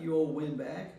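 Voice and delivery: ordinary talk, nothing marked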